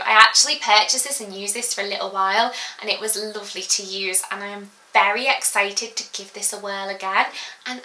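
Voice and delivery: ordinary delivery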